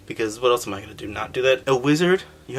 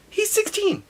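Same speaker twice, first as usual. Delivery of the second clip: much higher, exaggerated shocked voice; mock disbelief. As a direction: act stunned he's only sixteen. Mock disbelief at Link's age.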